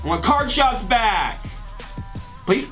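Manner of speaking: ordinary delivery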